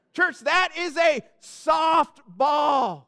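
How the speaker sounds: slow, loud, emphatic call; a shouted punchline to the congregation; preacher's shout. Real, not acted. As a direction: hammer home the point to the church.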